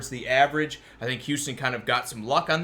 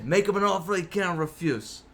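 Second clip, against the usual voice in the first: bad Italian mobster accent; a weak Godfather-style Italian accent; 4 semitones higher than usual. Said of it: "My Italian accent, just as bad as my Texan accent".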